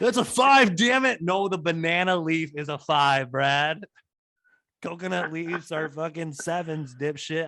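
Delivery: loud, high, exasperated outburst at first; mock-angry bickering. Castaway arguing with Brad over leaf cards, mock-furious. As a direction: play an indignant card player losing it. Acted card quarrel on the island.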